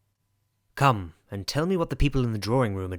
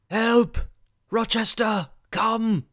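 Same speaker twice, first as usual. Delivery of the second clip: crying for help; 10 semitones higher, slower than usual. Acted. The wounded man calls Rochester from the room: high desperate cry. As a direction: call out for rescue, high and strained. Victim cries out at night.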